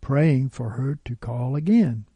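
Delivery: ordinary delivery, nothing marked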